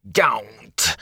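harsh rasped warning. A narrator's reading. Frightened peddler warning Rand off, rasped. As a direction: spit out a raspy don't. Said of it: "he rasped".

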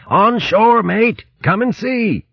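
high, urgent call from on deck; urgent shout. A narrator's reading. Sailor summoning mate from the galley, urgent high shout. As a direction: raise the pitch sharply to summon a mate. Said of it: "Berg shouted urgently".

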